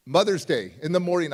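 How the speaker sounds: normal talking voice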